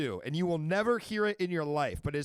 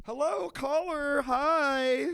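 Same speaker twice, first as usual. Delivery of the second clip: slow, high singsong hello; stretched, high phone-host welcome; high-pitched greeting; 11 semitones higher, 4 dB louder, much slower than usual. Real, not acted. Host greeting a new caller.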